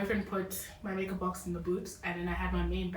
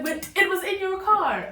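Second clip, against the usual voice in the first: high, loud, drawn-out protest; slow it down and push it high and loud, retelling the outburst; retold exasperated protest; 12 semitones higher, 7 dB louder, slower than usual. Caller retelling how she confronted the driver over her makeup box, exasperated protest.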